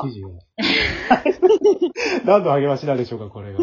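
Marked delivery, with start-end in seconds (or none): 0.4-2.2 high, embarrassed burst of laughter. Real, not acted. Leader laughing off praise from his members, high burst of laughter.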